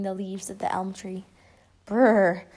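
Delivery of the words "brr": shivering. Acted. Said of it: "shivered Teacher Sue".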